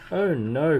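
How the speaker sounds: loud, high cry of dismay; an alarmed little outcry. A narrator's reading. Little Miss Neat seeing fireworks fall in the pond, dismayed cry. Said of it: "cried M-, Little Miss Neat".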